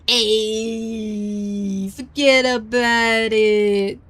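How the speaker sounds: drawn-out, cool-guy catchphrase voice; Fonzie. Acted. Streamer doing Happy Days slang, Fonzie impression. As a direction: stretch the vowels long and slow, laid-back swagger. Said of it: "Talk like Fonzie".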